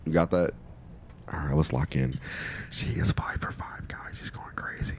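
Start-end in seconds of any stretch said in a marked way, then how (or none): 2.7-5.0 hushed whisper to the audience; very soft, voiceless aside. Real, not acted. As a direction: whisper it like a secret. Confidential aside to the viewers.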